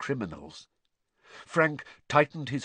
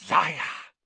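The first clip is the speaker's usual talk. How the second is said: raised, forceful breathy word; breathed accusation; 7 semitones higher, 5 dB louder than usual. A narrator's reading. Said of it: "breathed the second voice".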